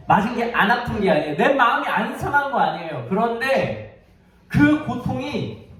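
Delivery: high, insistent sermon delivery; impassioned preaching. Real, not acted. Preacher urging the congregation to rise after hurt, impassioned. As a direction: drive each phrase home with fervor. Preacher builds to the main point.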